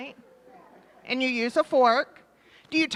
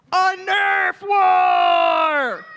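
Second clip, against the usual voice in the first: drawn-out slow shout; loud on request; 3 semitones higher, 8 dB louder, much slower than usual. Real, not acted. Child told to say it loud, shouting slowly. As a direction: belt it out slowly into the mic. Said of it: "Say it loud for everyone to hear".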